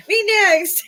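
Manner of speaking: high, loud little cat voice. Retold or imitated. Imagining her cat taking a ticket, high squeaky cat voice.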